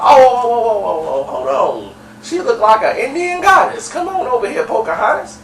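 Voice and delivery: high mock catcall; hollering at her. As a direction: imitate a man hollering at a woman across the room. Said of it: "was hollering at her".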